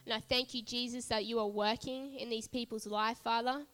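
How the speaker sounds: earnest congregational prayer. Real, not acted. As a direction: pray over the people with fervour. Leads a prayer.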